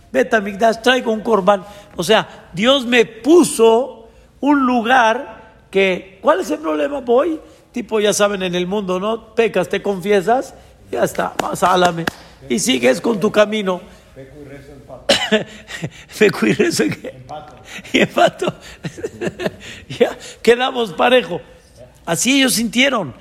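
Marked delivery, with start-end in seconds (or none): 0.1-1.6 lightly voiced carefree sinner, a bit higher and louder; casual sinner. 2.5-13.8 mock the one who sins and confesses; careless believer. 16.2-21.4 lightly voiced smug sinner, higher, laughing between; mock sinner. Mocking "sin and pray, we're even.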